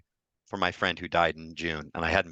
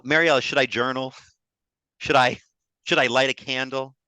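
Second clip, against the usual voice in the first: anxious pleading; 5 semitones higher, 5 dB louder than usual. Retold or imitated. [name] voicing his anxious self.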